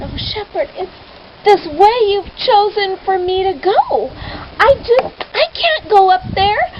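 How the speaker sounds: high, whiny pleading; frightened girl pleading with the shepherd; fearful pleading girl. Acted. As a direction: protest like a scared child. Much Afraid pleading fearfully.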